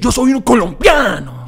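loud, high, proud Spanish declaration; big mock-proud Spanish accent; hammed-up Spanish accent. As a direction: shout it in showy Colombian Spanish. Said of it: "I can say it 'cause I'm".

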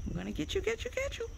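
high, rising sing-song threat; playful pet teasing. Real, not acted. Owner teasing the bird, high playful pet talk. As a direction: tease it in a squeaky pet-talk voice.

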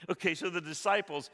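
loud, high, fervent preaching; preaching at full force. Acted. Preacher urging the congregation, loud and fervent. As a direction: drive it home to the congregation.

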